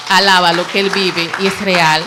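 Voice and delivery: fervent praise. Real, not acted. Testifying to the church, loud fervent praise. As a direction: lift the voice in full praise.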